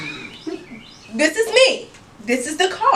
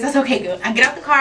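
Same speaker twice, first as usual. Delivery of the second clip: ordinary talk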